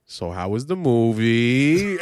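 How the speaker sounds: very slow, unbothered dad voice; deadpan dad. Retold or imitated. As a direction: ask it as if nothing happened at all. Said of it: "my dad's response was".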